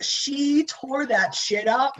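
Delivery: slow, emphatic rave; amazed; awed rave. Real, not acted. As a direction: stretch it out in awe. Raving about a performance.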